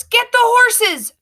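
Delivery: high, loud, slow command; shout. A narrator's reading. Boy shouts to the girl riding alongside, loud. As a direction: bellow the order mid-gallop. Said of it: "the boy shouts".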